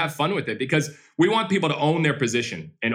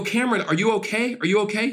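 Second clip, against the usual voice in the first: concerned check-in; 8 semitones higher than usual. Retold or imitated. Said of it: "I go".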